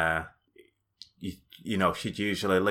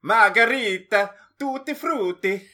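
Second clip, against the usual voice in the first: mock Italian; 11 semitones higher, 5 dB louder than usual. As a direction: rattle off food words like fake Italian. Fake Italian when asked.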